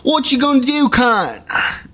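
high, squawky British parody; mock British accent. Parodies the rapper's British-sounding line.

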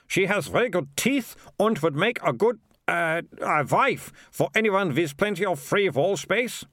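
high, lilting official's voice; tentative sales pitch, groping for words; high-pitched mayor, stumbling a little; hesitant mayor. Acted.

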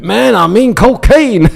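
slow, high, loud American drawl; a knowing American dealer leaning in; mock American voice offering cocaine; American accent.

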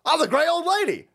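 high, preening mock voice of the New York Times; a hammed-up high prissy voice; mock pompous newspaper. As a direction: preen like a vain old lady. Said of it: "And they go".

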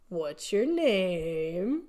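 slow, drawn-out mock question; imitating a contestant. Contestant presenting her entry to Greg, drawn-out imitation. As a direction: draw it out slowly, as if acting her. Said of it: "she says to Greg".

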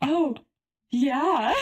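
high, loud knowing agreement; playful, exaggerated realization; retold sudden understanding. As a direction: act out catching the hint, amused. Acted dawning realization, laughing.

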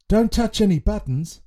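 put-on high boyish warning; boy character Curtis. A narrator's reading. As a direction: caution friends in a child's voice. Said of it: "said Curtis".

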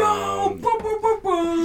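high, loud hype-crew shout; big, high booming hype; hype cheer. Real, not acted. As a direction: whoop it up for a favourite rapper. Cheering on a favourite rapper.

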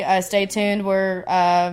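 usual speaking voice

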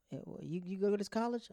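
voicing the dad, pitch jumping up; a curious older father asking; friend's dad. A dad quizzing his son's new friend, lightly voiced.

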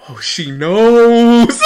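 slow, drawn-out doomed whine; mock dread. Kid hearing mom call his name, mock dread. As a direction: stretch it out like you're doomed. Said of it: "I'm just like".

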